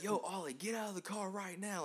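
high mock yell without volume; retold scream. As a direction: imitate a friend's urgent scream, held back. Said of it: "like, screaming".

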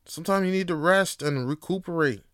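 ordinary talk, nothing marked

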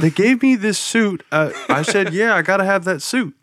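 high, loud hammed-up spokesman voice; Michael Jordan. Athlete defending his suit, high hammed-up voice. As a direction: pitch it up, guilelessly explaining.

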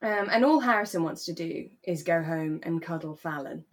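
normal talking voice

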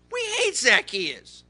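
acted angry protest; livid. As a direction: spit it out as an indignant crowd.

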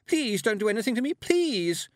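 high, loud, hurried pleading; terrified cry. A narrator's reading. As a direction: beg in terror, pitch way up. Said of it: "she cried".

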